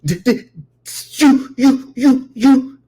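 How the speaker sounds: high, loud stuck stammer; hammered, repeated false starts; mock stutter. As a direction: imitate someone stuck on the first word. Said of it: "before he can get three words out".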